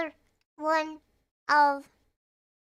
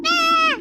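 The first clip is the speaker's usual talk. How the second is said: sudden loud high jump-scare shout; startling boo; 12 semitones higher, 10 dB louder than usual. Pretend. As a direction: yell to startle someone. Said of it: "Did I scare you?".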